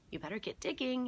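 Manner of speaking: ordinary delivery, nothing marked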